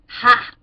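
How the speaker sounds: loud scornful ha; mocking ha. A narrator's reading. Judge scoffing at Matthew's sand house. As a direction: bark a loud mocking laugh. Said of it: "said the judge".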